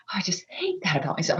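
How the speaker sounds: fast, unvoiced whisper. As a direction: whisper in frustration. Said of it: "I have said to my husband".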